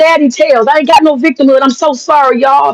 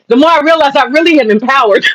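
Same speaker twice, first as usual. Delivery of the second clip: ordinary delivery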